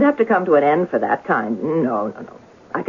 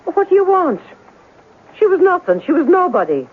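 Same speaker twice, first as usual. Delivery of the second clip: higher, sharp and dismissive; irritated snap; 6 semitones higher than usual. Acted. Landlady brushing off the newspaper man, irritated. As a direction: brush him off hard.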